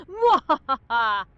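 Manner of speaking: slow drawn-out mwahaha; villain laugh. Pretend. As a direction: laugh like a supervillain. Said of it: "Get more intimidating".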